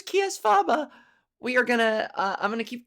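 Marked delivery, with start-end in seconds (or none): 0.0-0.9 Kies Faba pitched much higher; a squeaky, playful Kies Faba; high mock title. Naming listeners grandly.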